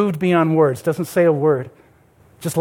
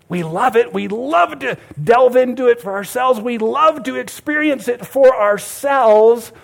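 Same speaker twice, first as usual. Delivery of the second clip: higher, building preacher's cry; impassioned preaching; 5 semitones higher, 4 dB louder, slower than usual. Real, not acted. Preacher challenging the congregation about keeping the gospel, impassioned preaching. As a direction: preach it louder and higher, stretching the end.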